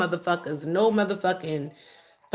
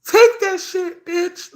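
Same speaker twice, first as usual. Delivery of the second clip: much higher, louder, slow, defiant yell; angry shout; 10 semitones higher, 7 dB louder, slower than usual. Real, not acted. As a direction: yell it at the haters. Telling off the haters.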